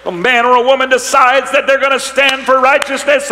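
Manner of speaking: high, loud, thundering sermon; fiery pulpit preaching, voice climbing; full-force preaching. Acted. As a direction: preach it hard to the congregation.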